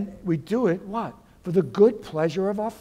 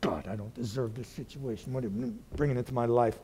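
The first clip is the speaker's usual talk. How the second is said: mild grumbling prayer; 4 semitones lower, 7 dB quieter than usual. Retold or imitated. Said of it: "we can mutter against God".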